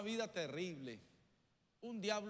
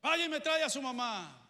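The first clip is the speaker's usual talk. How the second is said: angry teacher order; 5 semitones higher, 10 dB louder than usual. Retold or imitated. Teacher orders boy fetch mother.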